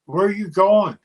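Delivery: high, loud, mock yell at the runaway; a pilot's retold shout after a man walking off. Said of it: "he yells at Mad Dog".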